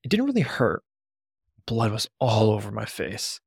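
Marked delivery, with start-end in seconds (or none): none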